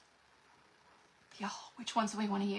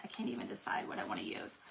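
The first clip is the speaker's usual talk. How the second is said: fast, much lower and softer whisper; 14 semitones lower, 10 dB quieter, faster than usual. Real, not acted. Crafter whispering her indecision to viewers, hushed and fast. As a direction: mutter it under your breath quickly.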